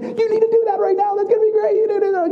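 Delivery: high, loud eager push; eager little sister. Retold or imitated. Daughter urging her brother to get saved, high and eager. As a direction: urge him on like an excited little sister. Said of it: "starts going and saying".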